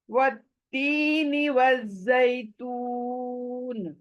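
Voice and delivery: slow, beautified Quranic recitation; melodic recitation. Shown as a demonstration. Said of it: "beautifully".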